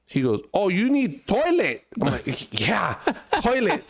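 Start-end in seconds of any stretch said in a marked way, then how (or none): 0.5-1.8 high, slow mock broken English. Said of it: "But it's broken English". 2.6-3.8 mock echo. Echoing vendor in mock voice.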